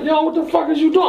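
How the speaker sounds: high-pitched disbelief; alarmed retold challenge. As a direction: demand an answer in a high, startled voice. Confronting man who is shooting him.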